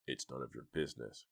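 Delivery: low, soft menacing growl. Acted. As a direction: growl it like a thug. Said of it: "growled the man".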